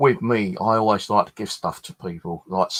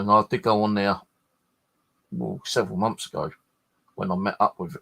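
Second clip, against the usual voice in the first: ordinary delivery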